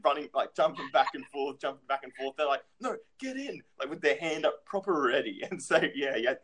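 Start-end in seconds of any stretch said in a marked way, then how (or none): 2.8-3.6 high, soft mock command; ordering parent. Parent with hand up ready, mock ordering. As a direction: imitate a parent insisting, in a small high voice.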